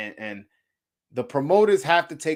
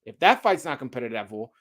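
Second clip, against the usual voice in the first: ordinary talk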